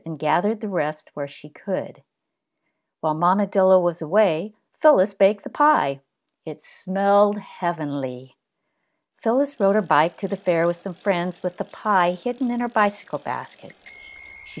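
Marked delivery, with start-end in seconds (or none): none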